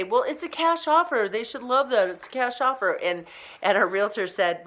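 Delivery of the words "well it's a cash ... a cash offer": imitating the buyer. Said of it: "he was trying to say".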